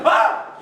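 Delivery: sudden high, loud breathy shriek; startled yelp. Retold or imitated. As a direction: jump out of your skin. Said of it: "he's like".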